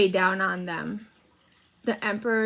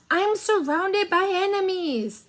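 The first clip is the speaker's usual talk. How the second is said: high, petulant whine, a bit louder; high-pitched whining complaint; whining emperor; 10 semitones higher, 3 dB louder than usual. A narrator's reading. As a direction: whine it like a spoilt ruler sulking. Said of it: "the Emperor whined".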